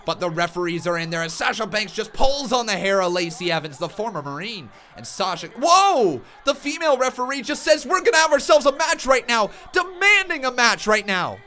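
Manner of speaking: high, loud hyped wrestling commentary; live play-by-play. Acted. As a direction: call the brawl like a ringside announcer.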